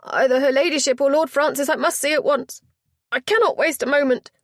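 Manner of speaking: high, loud, alarmed plea; a frantic lady demanding help at once; quivering alarm. Acted. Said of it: "raised in quivering alarm".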